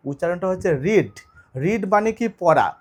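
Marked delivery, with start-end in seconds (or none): 0.8-1.1 রিড pronounced "reed" for the present tense; long-vowel pronunciation. Shown as a demonstration. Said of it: "উচ্চারণটা কি".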